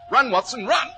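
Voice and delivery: shouted high and loud over the rain; urgent call to run from the storm. Acted. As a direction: yell at him to run. Urging through the storm.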